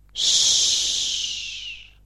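long hushed hiss of air; breath-like yes. A narrator's reading. Hidden boy answering the spiritists as a ghost, breathy hiss.

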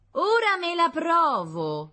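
high, slow, booming proclamation; loud public announcement. A narrator's reading. Concierge announces to all the tenants, loud and showy. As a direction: announce it grandly to the whole building. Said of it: "annunciò la sora Matilde a tutto il casamento".

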